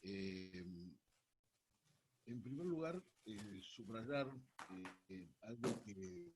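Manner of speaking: faint and distant, far from the mic; speaking away from the phone; phone held away. Real, not acted. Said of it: "Néstor, acércate al teléfono".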